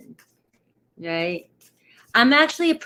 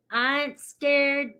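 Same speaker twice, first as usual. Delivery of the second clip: slow, higher slangy drawl; slang accent; 5 semitones higher, slower than usual. Pretend. Host telling viewers retrograde is no worry, slang accent. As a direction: draw it out in a playful slang accent. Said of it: "the slang and the accents".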